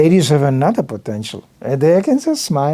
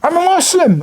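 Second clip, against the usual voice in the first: passerby's reply; 12 semitones higher, 3 dB louder than usual. Retold or imitated. Passerby announcing his faith.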